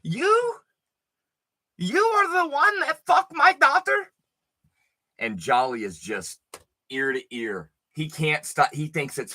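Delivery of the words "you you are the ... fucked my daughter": put-on high-pitched accented mother, squeaky and outraged; angry Albanian mom. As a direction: repeat it as a shrill mock mom. Repeated angry mom line.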